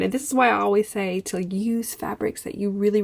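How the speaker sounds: ordinary delivery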